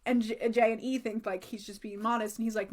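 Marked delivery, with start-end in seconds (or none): none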